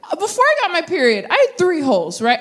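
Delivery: ordinary talk, nothing marked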